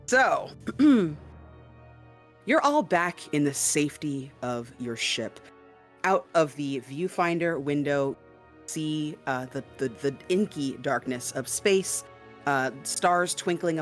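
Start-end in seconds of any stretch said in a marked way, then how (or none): none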